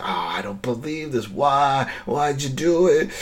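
mock dismay, rising on each why; pained disbelief. Man to the woman who confessed the killing, mildly dismayed.

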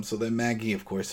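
ordinary talk, nothing marked

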